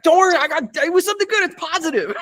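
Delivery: very high squealed reassurance, easing in volume; retold excited screaming. Actor retelling good news to friends on a call, high mock scream. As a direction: act out shrieking joy while trying to reassure. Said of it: "shouted and I was screaming".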